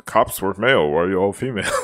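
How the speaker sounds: whiny jab at the cops; mock complaint. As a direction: sneer it like a petty complainer. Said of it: "I wouldn't say".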